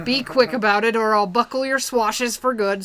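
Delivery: blustering hammed-up pirate captain. Captain Gulliver warning the crew to hurry, loud pirate voice. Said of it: "And then".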